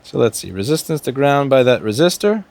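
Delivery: normal talking voice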